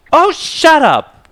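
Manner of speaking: very high, slow, loud snap; snapping. A narrator's reading. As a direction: snap at him furiously. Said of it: "Justice glared at him".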